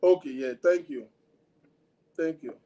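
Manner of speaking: ordinary delivery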